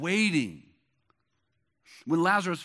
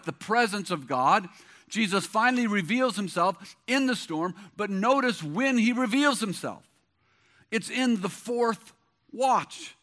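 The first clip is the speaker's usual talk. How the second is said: normal talking voice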